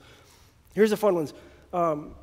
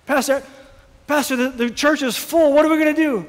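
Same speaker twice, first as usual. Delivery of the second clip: much higher, louder frantic pleading; panicked plea; 7 semitones higher, 7 dB louder than usual. Retold or imitated. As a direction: beg the pastor in a panic. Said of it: "come to the pastor and say".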